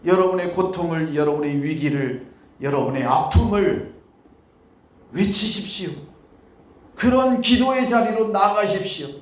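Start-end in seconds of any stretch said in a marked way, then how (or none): none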